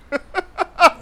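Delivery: high, loud taunting cackle; his creepy laugh. Acted. Tycoon laughing at the angry stand owner, creepy laugh. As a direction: burst into a high mocking laugh. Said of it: "laughing, that laugh of his".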